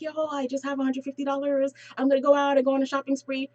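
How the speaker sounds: giddy, put-on excitement, rushing toward the end; mock excited. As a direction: imitate someone thrilled with sudden cash. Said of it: "they're all excited".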